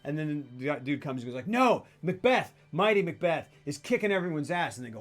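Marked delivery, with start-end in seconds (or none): 1.5-4.8 mock heroic messenger. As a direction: announce it like a battlefield herald. Acts the messenger praising Macbeth.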